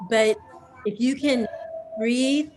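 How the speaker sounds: ordinary delivery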